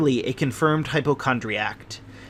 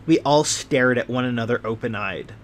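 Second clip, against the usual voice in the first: ordinary delivery, nothing marked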